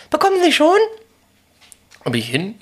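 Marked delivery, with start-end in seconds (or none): 0.1-0.8 high, loud salesclerk voice; shop clerk voice. Retold or imitated. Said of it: "dann kam".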